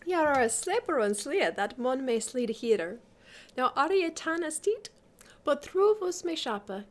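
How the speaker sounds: put-on lilting recitation in old pronunciation; Middle English verse reading. A narrator's reading. Lady teases the sleeper, lilting Middle English recitation.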